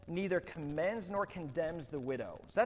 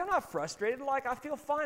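mock protest; 10 semitones higher, 4 dB louder than usual. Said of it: "I was like".

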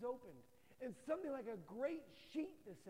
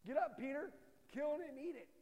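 higher and louder, a booming divine order; God's loud command; 4 semitones higher, 6 dB louder than usual. Retold or imitated. God ordering Peter to kill and eat, loud command. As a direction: call down like God giving a command.

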